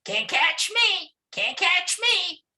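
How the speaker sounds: very high, squeaky little monkey taunt; singsong teasing, far above normal pitch. Acted. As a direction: stretch it out in a tiny high voice. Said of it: "teasing Mister Alligator".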